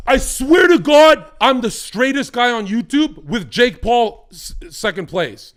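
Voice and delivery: very loud, high, drawn-out insistence; emphatic protest. Real, not acted. Podcast guest insisting to the host, loud emphatic protest. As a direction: swear it at the top of your voice. Loudly insisting amid teasing.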